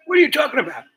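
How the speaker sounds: loud, high-pitched imitated outrage; mock indignant woman. Woman answering an accusation of sin, mock indignant outburst. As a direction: demand it in a shrieky caricature of a woman. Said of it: "And she said".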